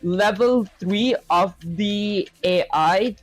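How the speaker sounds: very slow, typing as he talks; drawn-out words while typing; slow dictation. Real, not acted. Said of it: "slowly dictating while typing".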